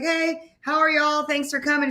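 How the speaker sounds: high-pitched, upbeat hello to a crowd; stage greeting. Retold or imitated. Retelling Erica's greeting from the stage, high-pitched stage hello. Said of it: "Erica on stage".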